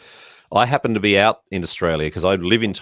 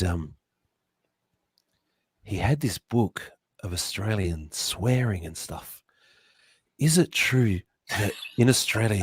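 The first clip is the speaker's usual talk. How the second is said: quiet mimicry of Michael's voice; a hushed impression of a friend; imitating Michael; 8 dB quieter than usual. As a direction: softly play Michael asking a shy question. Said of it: "so I can be Michael".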